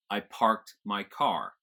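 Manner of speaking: "parked" and "car" with strong R; General American accent. Shown as a demonstration. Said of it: "an American would pronounce both".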